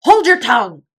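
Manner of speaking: sudden fierce, loud order; high, very loud rebuke; ferocious. A narrator's reading. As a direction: shout her down on jangled nerves. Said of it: "with a ferocity of accent that testified to his own jangled".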